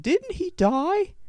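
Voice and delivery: put-on very high, slow little kid question. Other kid asks about LeBron, high slow kid voice. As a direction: ask it like a clueless small child.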